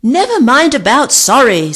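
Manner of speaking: loud, high scream. A narrator's reading. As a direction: scream it, cutting off the apology. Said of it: "screamed Vic".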